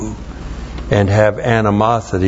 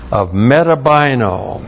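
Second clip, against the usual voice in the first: usual speaking voice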